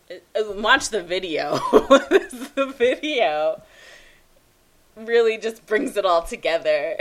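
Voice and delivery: talking through laughter; laughing. Real, not acted. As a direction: laugh while recommending it. Laughing about the video.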